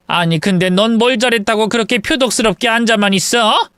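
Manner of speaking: venomous mother-in-law accusing her son's wife; furious mother-in-law. Acted. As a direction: tear into the daughter-in-law while pulling her hair. Mother-in-law attacks daughter-in-law.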